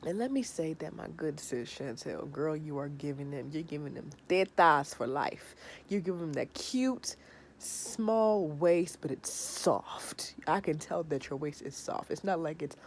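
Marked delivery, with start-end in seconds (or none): none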